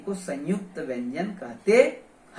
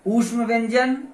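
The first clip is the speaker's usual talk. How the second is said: normal talking voice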